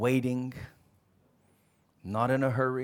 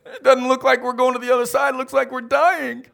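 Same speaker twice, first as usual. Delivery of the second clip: much higher, louder frightened complaint; panicked disciples; 9 semitones higher, 10 dB louder than usual. Acted. Disciples complaining to Jesus they're sinking, frightened. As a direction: whine it in terror.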